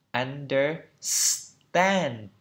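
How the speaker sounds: understand drawn right out, "under-sss-tand"; slow correct breakdown. Shown as a demonstration. Teacher breaking it down for learners, very slow understand with long s. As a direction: stretch understand slowly, holding the s into "tand". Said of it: "Phải đọc đúng theo phiên âm".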